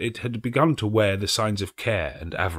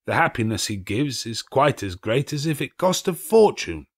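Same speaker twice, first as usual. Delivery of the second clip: raised, warmly insistent pleading; heated defence; 5 semitones higher, 5 dB louder than usual. A narrator's reading. Scrooge heatedly defends old Fezziwig to the spirit. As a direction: argue it hotly, carried away. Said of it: "heated by the remark and speaking unconsciously".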